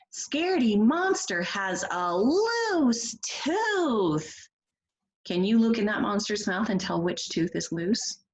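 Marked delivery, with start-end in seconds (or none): 0.1-4.5 big, slow, exclaimed read with high swoops; feeling and excitement. Shown as a demonstration. Teacher reading an all-capitals exclamation to first graders, excited. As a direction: stretch out LOOSE TOOTH, high and dramatic. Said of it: "a lot of feeling and excitement".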